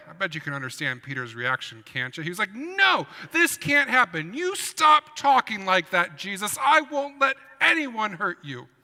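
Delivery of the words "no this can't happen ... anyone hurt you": high loud pleading yell; Peter yelling. Acted. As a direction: shout at him to stop, high and loud. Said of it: "Peter screamed and yelled at Jesus".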